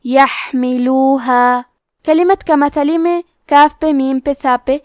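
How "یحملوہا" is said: یحملوہا with the ہا as a faint, breathy whisper of air; یحملوہا, letting the ہا fade out lightly; hams on ha. Shown as a demonstration. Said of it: "تو ہم یہ کلمہ کو ایسے پڑھیں گے".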